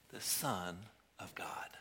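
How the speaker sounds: slow reverent whisper; a hushed whisper of the holy name; whispered reveal. Acted. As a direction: breathe it out in awe, slowly. Preacher reveals the child's name.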